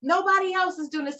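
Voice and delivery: high-pitched exasperated complaint; retold screaming frustration. Retelling her old rants about healing alone, high exasperated whine. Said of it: "days where I would scream".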